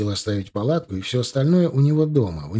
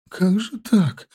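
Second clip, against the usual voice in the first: shaky, high, tearful question; trembling voice; 8 semitones higher, much slower than usual. Acted. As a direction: voice trembling with distress. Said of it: "повторяла дрожащим голосом".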